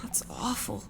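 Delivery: soft whispered shock; horrified whisper. Acted. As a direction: breathe it out in horror. Shocked at the blood.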